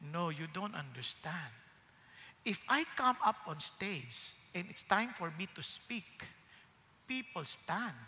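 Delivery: low, slow, very soft gravity; Abraham Lincoln. Retold or imitated. President answers why he stands for scripture, low slow solemnity. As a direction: play a solemn statesman, measured and hushed. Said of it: "Ang sabi niya".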